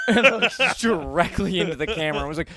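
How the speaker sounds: usual speaking voice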